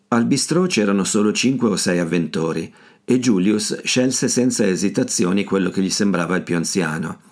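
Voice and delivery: usual speaking voice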